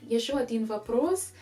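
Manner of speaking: usual speaking voice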